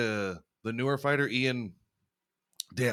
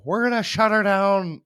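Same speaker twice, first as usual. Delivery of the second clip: mock bar shout; 10 semitones higher, 8 dB louder than usual. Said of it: "if they say the same thing".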